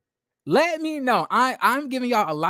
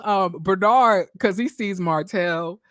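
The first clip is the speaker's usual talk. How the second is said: usual speaking voice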